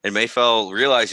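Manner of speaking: ordinary delivery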